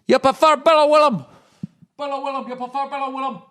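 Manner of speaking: high mock Scottish voice, dropping to a mutter; mock Scottish accent. Host jokes a Scottish listener's voice in a high mock accent. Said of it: "Oh, I'm Scottish".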